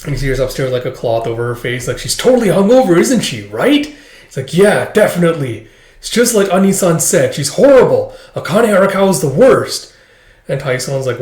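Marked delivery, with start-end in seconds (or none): 2.0-3.9 much higher, louder, fast gossip; gleeful gossip. Retold or imitated. Juniors gossiping. 4.5-9.9 higher, louder eager gossip; gleeful badmouthing. Junior badmouthing her.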